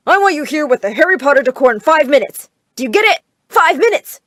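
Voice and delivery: loud, insistent phone order; demanding order. A narrator's reading. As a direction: lay down a firm demand. Father barking orders at decorators.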